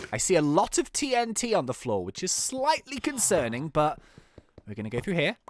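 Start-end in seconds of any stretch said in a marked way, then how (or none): none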